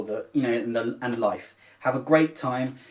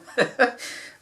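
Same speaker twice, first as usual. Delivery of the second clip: embarrassed laugh; 12 semitones higher than usual. Real, not acted. Laughs at crying on camera.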